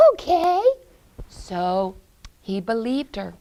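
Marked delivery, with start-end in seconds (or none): 0.1-0.6 put-on high little-boy voice; trusting young Hansel. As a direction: agree in a boyish pitch. Hansel agrees to follow.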